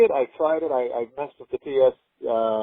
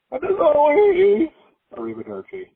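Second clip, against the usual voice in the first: showy sign-off; 6 dB louder, slower than usual. Pretend. Signature sign-off, drawn out.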